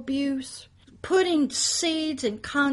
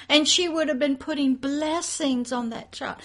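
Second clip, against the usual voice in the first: ordinary delivery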